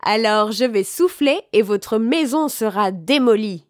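ordinary delivery

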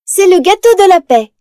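high, loud innocent fib; sweet lie. Acted. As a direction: lie with a bright fake smile. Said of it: "ment-elle".

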